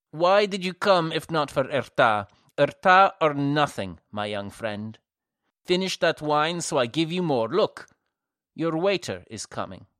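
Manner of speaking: lightly voiced giant waiter; hearty waiter. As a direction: boom it out like a proud host. Giant waiter urges guests.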